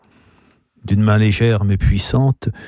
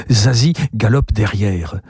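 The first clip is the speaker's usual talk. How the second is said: ordinary delivery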